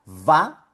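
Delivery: "va" alone with rising tune; isolated syllable. Shown as a demonstration. Teacher giving the first syllable, rising "va". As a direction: leave "va" hanging upward. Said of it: "Primera sílaba".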